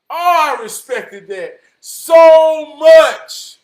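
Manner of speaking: very loud, high, drawn-out praise; booming, heartfelt outburst; impassioned shout. Real, not acted. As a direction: belt out your respect slowly.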